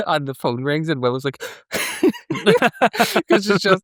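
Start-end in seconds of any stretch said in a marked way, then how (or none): 1.4-3.2 high shrieking squeal; high squeal laugh. Retold or imitated. Imitating Willow jumping at the phone, high squeal. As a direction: squeal in a startled fright.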